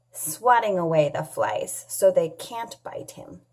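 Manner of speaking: ordinary talk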